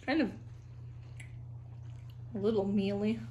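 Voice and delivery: speaking between bites; talking while chewing. Real, not acted. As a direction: mouth partly full of food. Seen 0: Bites tomato at -4, jaw working and lips pressed while talking.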